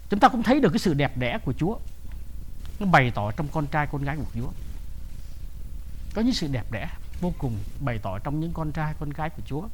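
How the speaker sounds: low, soft, subdued voice; hushed reflection. Real, not acted. Preacher reflecting on God's beauty in his children, hushed. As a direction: drop right down and speak softly.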